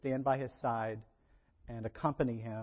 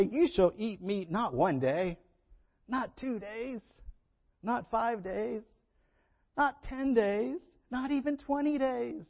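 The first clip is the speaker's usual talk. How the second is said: each phrase higher and slower; rising emphasis; 8 semitones higher, slower than usual. Acted. God to the grumbling people, climbing higher with each phrase. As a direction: build the list up in disbelief.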